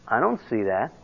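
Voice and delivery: low, soft mock doubter; unconvinced. That guy answering the logical case, low and unconvinced.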